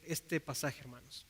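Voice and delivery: ordinary talk, nothing marked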